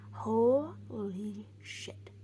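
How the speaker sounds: slow, stunned whisper; awed whisper. Retold or imitated. Whispering to the healer, stunned. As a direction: breathe it out in awe.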